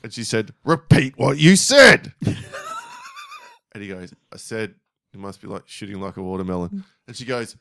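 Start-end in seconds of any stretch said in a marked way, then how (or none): 0.6-2.1 loud, slow, higher mock teacher fury; shouted teacher demand. Imitating teacher pressing silent pupil harder, slow shouted demand. 4.3-6.8 soft, subdued imitation of a caught pupil; sheepish admission. Imitating pupil forced to repeat remark to teacher, sheepish and quiet.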